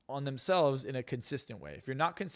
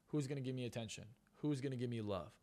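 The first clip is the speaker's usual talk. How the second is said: mock self-pity; 2 semitones lower, 8 dB quieter than usual. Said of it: "they sit there and they go".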